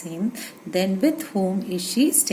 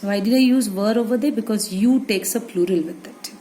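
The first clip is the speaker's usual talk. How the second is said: ordinary talk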